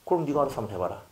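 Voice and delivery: ordinary delivery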